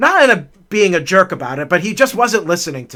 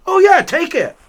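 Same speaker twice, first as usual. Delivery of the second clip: breezy teacher; 11 semitones higher, 4 dB louder than usual. Retold or imitated. Teacher insisting kindly.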